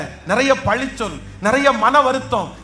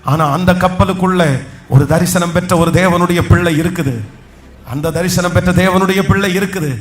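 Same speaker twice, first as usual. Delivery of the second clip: loud, lower proclaiming voice, slowing; full-force preaching; 4 semitones lower, 6 dB louder than usual. Acted. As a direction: proclaim it loudly over the crowd. Preacher proclaims strength, claps. (உரத்த குரலில் ஆவேச பிரசங்கம்)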